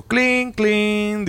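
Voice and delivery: high imitated electronic clink; ping it out like a ghost sensor beeping. Telling friends about the sensor, high imitated clink. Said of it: "suena un sensor".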